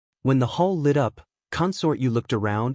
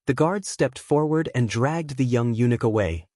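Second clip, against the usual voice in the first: usual speaking voice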